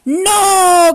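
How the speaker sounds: high, loud defiant no; three pigs shouting no. Acted. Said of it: "gritaron los tres cerditos".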